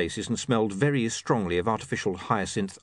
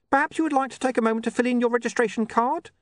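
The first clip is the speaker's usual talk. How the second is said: high-pitched, pleading little imp; anxious programmed helper, pitched way up; desperate imp; 13 semitones higher, 3 dB louder, faster than usual. Acted. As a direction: offer it high, desperate to please.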